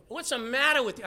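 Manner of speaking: indignant Eli snapping at the praying woman; scolding priest. Retold or imitated. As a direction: scold her like a priest who has misjudged her. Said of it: "I'm paraphrasing now".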